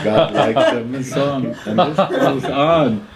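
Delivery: words broken up by real laughter; can't hold back the giggles, loud; laughing. Real, not acted. Storyteller laughing at his own joke. Narrator cracking up at own tale.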